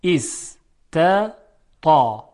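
syllable by syllable است، تا, contrasting thin and thick t; dropping onto a dark, emphatic تا; light then heavy ta. Shown as a demonstration. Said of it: "بعد حرف تا".

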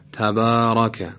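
slow تبارک, dark fatha on the r; heavy ra. Shown as a demonstration. Tajweed teacher illustrating the rule, heavy ra in تبارک.

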